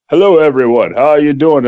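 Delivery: low, dignified party-guest voice; code-switched posh greeting. Shown as a demonstration. Guest arriving at a posh party, dignified code switch. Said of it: "Time to code switch".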